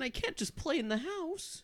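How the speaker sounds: a nervous mouse explaining itself; frightened prey animal. Acted. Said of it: "talking mouse".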